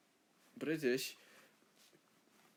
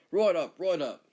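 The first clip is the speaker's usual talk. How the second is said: loud, higher mock Australian call; loud cheer; 4 semitones higher, 10 dB louder than usual. Australian congratulating whoever spots the difference, loud shout. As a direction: shout it out as broad Australian approval. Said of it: "then we'll say".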